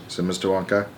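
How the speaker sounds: plain reading voice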